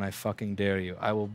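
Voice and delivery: usual speaking voice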